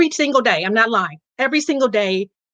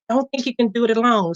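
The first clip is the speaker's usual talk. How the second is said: ordinary talk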